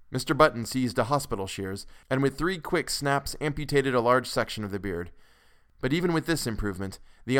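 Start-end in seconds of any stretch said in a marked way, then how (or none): none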